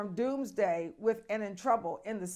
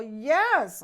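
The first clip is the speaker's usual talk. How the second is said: emphatic yes; 3 semitones higher, 8 dB louder than usual. Real, not acted. Emphatic reaction to glacier news.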